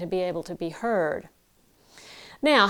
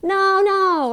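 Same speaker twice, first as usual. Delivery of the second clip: high, shrill-free yelled no, much higher and louder; screaming no; 11 semitones higher, 7 dB louder than usual. Shown as a demonstration. Coach demonstrates screaming at a cat, much higher and louder. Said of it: "volume would be screaming at the cat".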